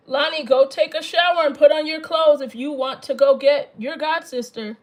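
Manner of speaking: loud call across the house. Real, not acted. Mother calling kid to shower.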